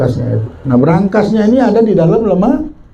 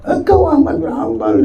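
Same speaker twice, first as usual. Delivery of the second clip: much higher, amazed question; astonished; 8 semitones higher than usual. Acted. Baker learning the guest is the imam, astonished. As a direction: gasp it out in disbelief.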